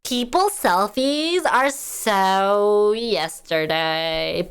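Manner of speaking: put-on slow, drawn-out Valley Girl accent; slow, airy California Valley Girl accent. Shown as a demonstration. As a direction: stretch it out like a bored Valley Girl. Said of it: "talk in a, like, Valley Girl accent".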